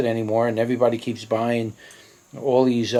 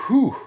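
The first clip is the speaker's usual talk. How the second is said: sharp exhale; 12 semitones higher than usual. Real, not acted. Gasp after hot pepper bite.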